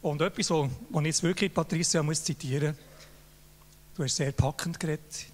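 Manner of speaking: ordinary delivery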